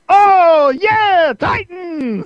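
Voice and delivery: high, loud photo cheer; group cheer. Retold or imitated. The Titans cheering for Jimmy Olsen's photo, high and loud. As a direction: cheer like kids posing for a picture. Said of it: "Everybody say".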